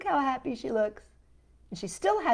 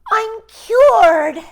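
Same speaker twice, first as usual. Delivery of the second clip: much louder, higher joyful shout; 12 semitones higher, 17 dB louder than usual. A narrator's reading. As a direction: shout it out in triumph. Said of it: "she shouted".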